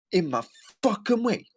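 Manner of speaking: very high, hammed-up gripe; exaggerated mocking mimicry. Repeating her clip for listeners, shrilly mocking. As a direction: push the imitation way up, leaning on FUCKING.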